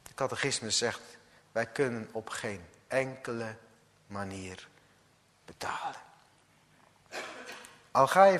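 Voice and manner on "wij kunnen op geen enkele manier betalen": hushed. Real, not acted. Preacher drops to a hush.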